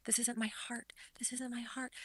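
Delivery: imitating his meek whisper, barely audible; mumbled whisper. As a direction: breathe it out like a mumbling defendant. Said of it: "kept mumbling and kept getting softer and softer".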